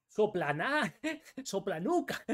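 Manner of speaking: high-pitched, breaking into real laughter; giggling at your own name-calling; laughing taunt. Real, not acted. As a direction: crack up midway through the insult. Cracks up at own insults.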